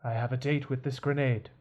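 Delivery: low, very soft and halting butler; resigned butler, quiet and low, with long pauses; world-weary old servant. Acted. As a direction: speak low and softly, as if giving up. Butler bids farewell.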